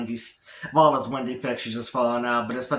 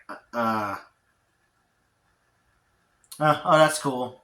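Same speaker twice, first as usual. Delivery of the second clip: absent-minded, halting reply; distracted; slower than usual. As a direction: answer slowly, only half listening. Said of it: "not really paying attention to her".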